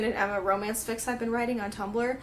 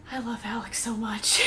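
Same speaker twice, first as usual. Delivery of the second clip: fond whisper. Real, not acted.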